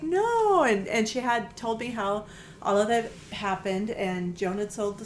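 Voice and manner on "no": high, louder, drawn-out no; mock caller. Magazine caller assuring the owner, mock cheerful. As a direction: sing out a cheerful no.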